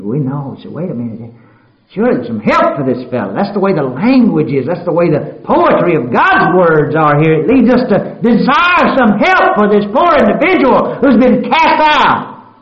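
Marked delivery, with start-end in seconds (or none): 1.9-12.5 high, loud preaching cry, rising to a shout; full-force preaching. Acted. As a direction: build the plea to a ringing shout. Preaching climax, voice rises.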